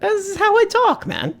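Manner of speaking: very high put-on voice; silly falsetto. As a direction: defend yourself in mock falsetto. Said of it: "Why are you saying it like that?".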